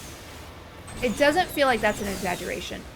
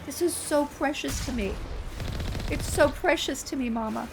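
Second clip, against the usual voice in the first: put-on precious child plea; 4 semitones higher, 4 dB quieter than usual. Mother imitates her daughter begging to keep the owl, high soft pleading. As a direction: plead like a sweet dramatic four-year-old. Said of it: "not crocodile tears".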